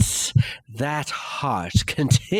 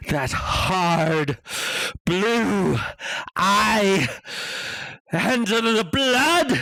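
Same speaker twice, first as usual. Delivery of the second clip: mounting dread; 8 semitones higher than usual. Acted. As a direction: let the terror swell to a cry. Narrator's mounting dread and rage.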